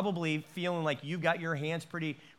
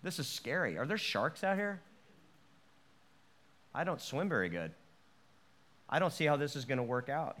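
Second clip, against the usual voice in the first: softer, nervous second thoughts; a hint of panic at sea; second-guessing the leap, quietly; mock scared; 4 semitones lower, 7 dB quieter than usual.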